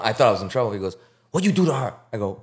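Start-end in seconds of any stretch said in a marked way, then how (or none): 1.3-1.9 high Vietnamese-accent impression; mock Vietnamese accent, higher voice; Tuan's Vietnamese accent. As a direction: imitate the Vietnamese coworker demanding an answer, pitched up. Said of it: "He talk like that".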